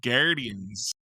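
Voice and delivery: Guardians drawn out in a mock northern accent; playful northern accent on Guardians, half joking. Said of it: "I said it with that accent".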